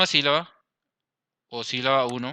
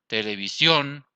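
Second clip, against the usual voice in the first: "televisión" slowly, landing on "sión"; slow "televisión" with a strong ending; stress on last syllable; slower than usual. Shown as a demonstration. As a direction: stretch and push the "sión" of "televisión". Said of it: "En la última".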